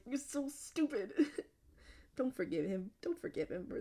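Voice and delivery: playful disbelief at a dumb choice; mock scolding. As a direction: scold a character who can't hear you. Scolding a book character.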